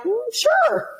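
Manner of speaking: very high, sing-out agreement; eager consent. Retold or imitated. Man agreeing to his guest's request, eager. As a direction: imitate a man agreeing gladly, pitch way up.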